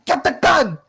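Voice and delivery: high-pitched, frantic henchman's cry; squealed alarm to the rest of the gang; panicked yell. Acted. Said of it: "one of them just yells".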